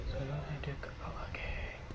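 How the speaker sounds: breathy hiding whisper; hushed whisper. Real, not acted. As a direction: whisper so the ghost won't hear. Hiding from ghost in game.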